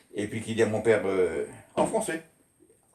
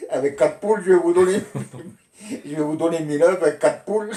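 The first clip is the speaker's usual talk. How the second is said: loud, higher mock disbelief; incredulous father; 6 semitones higher, 5 dB louder than usual. Father scoffing at the officer's thousand-egg order, loud disbelief. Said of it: "il dit".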